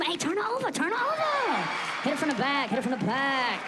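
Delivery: high, loud coach yelling; mock sideline coach; coaching dad. Father coaching his son: high, loud shouts. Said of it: "You be coaching him".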